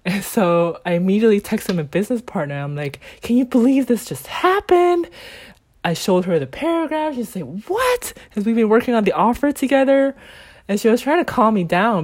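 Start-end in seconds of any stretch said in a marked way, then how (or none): 3.2-5.1 high-pitched incredulous question; retold disbelief. Texting business partner about the paragraph, high retold incredulity. 7.7-8.1 very high, rising exclamation of disbelief; mock shock. Business partner reacting to the paragraph, high-pitched shocked disbelief.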